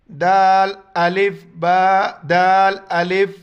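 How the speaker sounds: loud, drawn-out teacher spelling; spelling drill. Shown as a demonstration. Teacher to students, loud spelling drill. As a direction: call each letter out to the class. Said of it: "اس طرح کریں گے اس کو".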